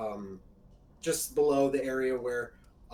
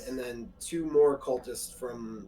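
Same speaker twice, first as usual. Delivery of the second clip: usual speaking voice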